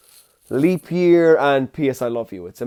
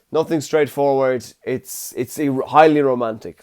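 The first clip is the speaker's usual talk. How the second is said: normal talking voice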